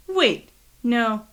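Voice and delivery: ordinary delivery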